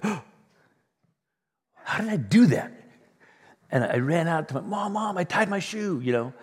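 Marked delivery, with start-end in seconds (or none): 0.0-2.7 put-on higher, louder amazed little kid; child amazed at tying a bow, much higher; childlike wonder. 4.6-6.0 put-on much higher child voice calling Mom; boy telling mom about his shoe, much higher; excited child calling.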